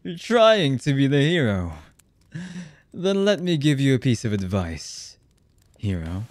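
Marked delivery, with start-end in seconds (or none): 0.0-1.7 raised, louder jeer after laughing; mocking. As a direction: jeer at the would-be hero. Villain mocks would-be hero.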